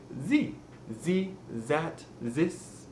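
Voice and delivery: "the", "that", "this" with a Z for the TH; French-accented "the", "that", "this", buzzing Z at the start. Shown as a demonstration. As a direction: say "ze, zat, zis" for "the, that, this". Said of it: "they do not say it the same way we do".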